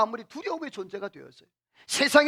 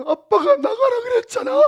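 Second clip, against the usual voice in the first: put-on much higher, tearful child's voice; crying child; 13 semitones higher, 5 dB louder than usual. Young son crying back at his father, tearful child voice. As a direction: sob it out like a hurt little boy. Son answering through tears.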